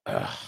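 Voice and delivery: low, drawn-out groan of reluctance; a tired low ugh; weary groan. Retold or imitated. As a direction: groan like you don't want to get up. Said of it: "I come in my office and go".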